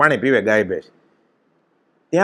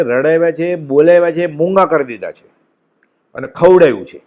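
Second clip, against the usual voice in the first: ordinary talk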